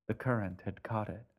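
usual speaking voice

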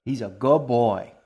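a doting queen cooing at her cat, sing-song. A narrator's reading. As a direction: croon it like praising a pet. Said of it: "in her sing-song voice".

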